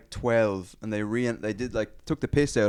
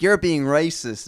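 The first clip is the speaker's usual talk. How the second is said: mock feminist accusation; 5 semitones higher, 5 dB louder, slower than usual. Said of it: "she's like".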